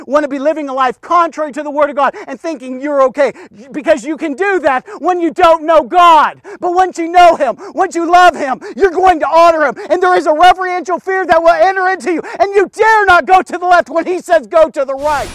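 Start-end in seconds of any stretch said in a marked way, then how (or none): 3.7-15.3 loud, impassioned, driving sermon climax; full-force preaching. Real, not acted. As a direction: preach it hard, pitch held high and urgent.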